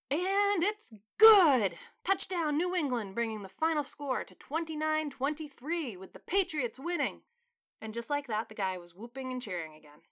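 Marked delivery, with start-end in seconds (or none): 0.1-7.2 high loud stretched call of the touchdown; excited play-by-play. Acted. TV broadcaster calls the winning touchdown, high and loud.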